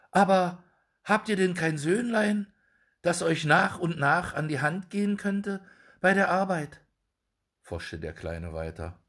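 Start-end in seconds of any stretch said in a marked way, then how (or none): 0.1-6.8 high, polite little dwarf. A narrator's reading. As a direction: greet him in a high small voice. Dwarf asks about the son. (hohe Zwergenstimme)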